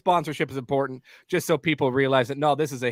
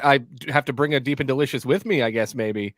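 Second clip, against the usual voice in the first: ordinary delivery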